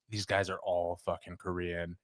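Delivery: muttered complaint. Retold or imitated. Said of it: "he's like".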